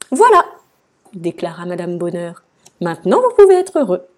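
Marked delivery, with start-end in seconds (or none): 0.1-0.4 very high, loud and cheery; triumphant announcement. A narrator's reading. Madame Bonheur annonce, triomphante. 2.8-4.1 high, loud and quick; cheery encouragement. A narrator's reading. Madame Bonheur encourage le roi.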